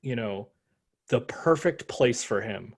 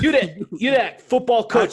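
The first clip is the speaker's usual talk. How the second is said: higher, louder, hammed-up Boston accent; mock Boston accent; 10 semitones higher, 7 dB louder than usual. Podcaster hamming a Boston guy at a bar, mock Boston accent. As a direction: yell at the coach like a Boston local. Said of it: "Boston accent".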